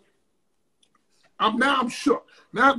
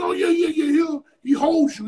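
breezy stranger; 5 semitones higher than usual. Retold or imitated. The stranger casually telling him the promoter holds people: high, offhand. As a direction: toss it off like it's nothing.

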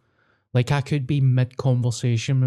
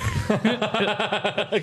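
laugh; 8 semitones higher than usual. Real, not acted. Laughing at the story.

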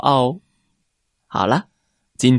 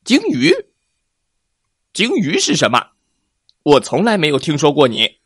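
puzzled; 4 semitones higher, 3 dB louder, faster than usual. Acted. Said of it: "疑惑地问".

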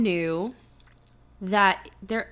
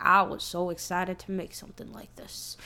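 lower, softer, half-hearted mock God voice; terrible Morgan Freeman; 6 semitones lower, 8 dB quieter than usual. Podcaster voicing God in Evan Almighty, lower, softer mock impression. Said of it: "my terrible Morgan Freeman impression".

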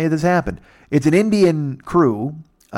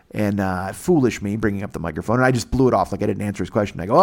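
ordinary talk

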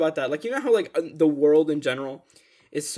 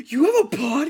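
high, loud disbelief; mock shocked question; 10 semitones higher, 7 dB louder than usual. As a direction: blurt it out in shock. Friends shocked.

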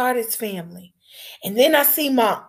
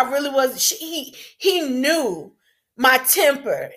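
ordinary talk

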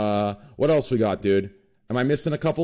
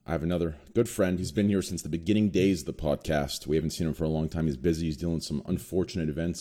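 quiet, low and somber; 9 semitones lower, 6 dB quieter than usual. Real, not acted. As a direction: speak softly about a struggling friend. Somber segment about friend.

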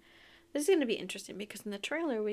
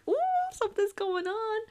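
mock suspense in a high voice; intrigued ooh; 11 semitones higher than usual. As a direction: pipe up as if scenting intrigue. Readers sensing intrigue, acted out.

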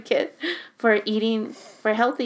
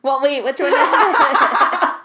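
slow, louder, laughing through the words; 4 semitones higher, 9 dB louder, slower than usual. Real, not acted. Laughing at her friend for switching answers. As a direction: crack up as you ask.

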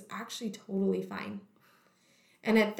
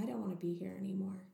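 whispered; 9 dB quieter, faster than usual. Retold or imitated. Dark thought under the sky.

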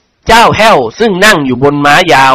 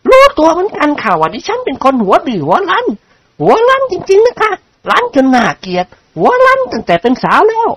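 high-pitched, insistent lady's voice; high voice of a self-confessed stubborn woman; outspoken lady character; 13 semitones higher than usual. Acted. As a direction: play a headstrong lady, pitched well up.

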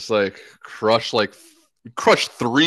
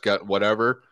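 usual speaking voice

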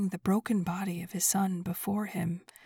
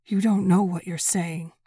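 shaking voice; 7 dB louder than usual. A narrator's reading. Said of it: "his voice shaking".

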